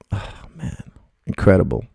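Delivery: breathy near-whisper of disbelief; hushed awe. Retold or imitated. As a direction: breathe it out, stunned. Reacting to student's long marriage.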